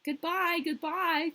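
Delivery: high, loud tearful farewell; crying goodbye. A narrator's reading. Prince and princess bid Gerda farewell, high and loud. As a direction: call goodbye after the departing girl. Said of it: "cried the prince and princess".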